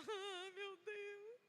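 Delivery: slow, high, hushed tearful moan; ai meu Deus chorado, bem lento e agudo; retold crying. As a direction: whimper it like you just hit your nose. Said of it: "Aí eu comecei a chorar".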